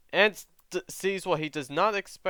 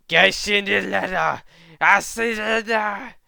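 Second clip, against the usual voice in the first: loud, higher made-up alien speech; angry creature jabber, stretched out; alien gibberish; 6 semitones higher, 7 dB louder, slower than usual. Acted. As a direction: rant in a strange alien tongue. Character's alien-language outburst.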